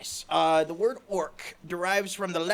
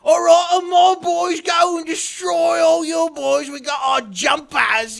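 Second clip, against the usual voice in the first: loud, high, slow shouted brag; rally your boys like a cocky orc warboss; rowdy orc boss; 11 semitones higher, 12 dB louder, slower than usual. Retold or imitated. Player mimicking an orc warlord bragging over a tabletop army, shouting. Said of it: "you just wanna be like".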